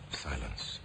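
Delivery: ordinary talk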